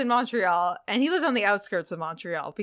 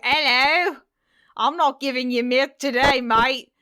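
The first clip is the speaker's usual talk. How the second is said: cartoon British accent, higher and louder; a British cow who sounds more Australian; 4 semitones higher, 5 dB louder than usual. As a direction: play a cheeky British cow refusing milk. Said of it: "And so she's a British cow".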